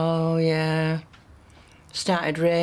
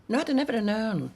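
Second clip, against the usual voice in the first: normal talking voice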